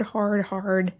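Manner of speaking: ordinary talk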